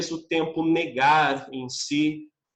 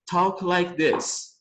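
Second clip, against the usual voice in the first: slow clear model; much slower than usual. Shown as a demonstration. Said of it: "eu posso escrever uma frase como".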